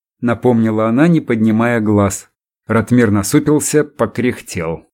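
usual speaking voice

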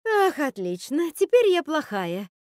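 high, loud wounded sarcasm, starting slow; melodramatic huff. A narrator's reading. Witch complaining to Rapunzel, melodramatic huff. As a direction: overplay the injured martyr.

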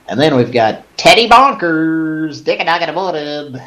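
hammed-up radio host shout-out; radio DJ intro. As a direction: announce it like a drive-time DJ. Announcing a chat name radio-style.

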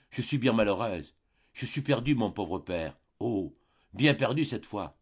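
ordinary delivery, nothing marked

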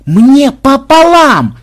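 high, loud, drawn-out scream; furious yell. A narrator's reading. Fake superior dismissing the officer's protest, yelling. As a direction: yell down the objection, word by slow word. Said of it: "завопил Ксенобайт".

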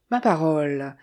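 ordinary delivery, nothing marked

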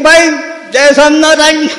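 high, excited parting call; hasty farewell. Retold or imitated. Speaker fleeing the room calls out a high hasty goodbye. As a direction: blurt the goodbye while running off.

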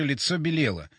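plain reading voice

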